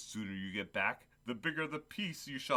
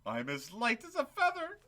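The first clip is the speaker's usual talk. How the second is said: giddy joy; 11 semitones higher than usual. Acted. Giddy Scrooge on Christmas morning.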